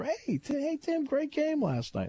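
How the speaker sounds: mock fans hailing him down the aisle; hammed-up fan greeting. Passengers calling to Tim as they board, hammed-up and drawn out.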